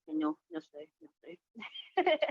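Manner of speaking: ordinary delivery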